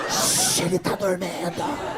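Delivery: slow hushed whisper with a giggle; excited whisper. Pretend. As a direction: shush them, laughing under breath. Partying thieves shushing each other.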